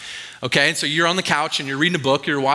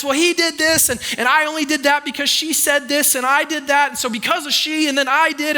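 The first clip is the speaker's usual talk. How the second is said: whiny excuses; 11 semitones higher than usual. Retold or imitated. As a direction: bicker like a kid shifting blame. Kids blaming each other.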